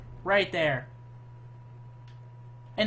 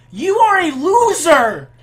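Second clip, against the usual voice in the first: higher, louder, slow mocking yell; drawn-out taunt; 10 semitones higher, 13 dB louder, slower than usual. Real, not acted. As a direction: drag it out to humiliate him. Repeats taunt, higher slower.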